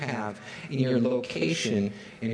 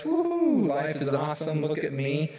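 gloating whoop; 3 semitones higher than usual. Retold or imitated. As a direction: brag it up, pure swagger. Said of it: "then we're like".

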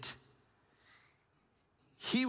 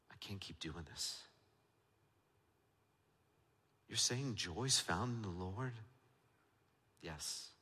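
much softer, low and intimate, lines spaced by long pauses; hushed preaching; 7 semitones lower, 9 dB quieter than usual. Acted. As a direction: draw them in close with a hush. Drops to a near-silent intimate preach.

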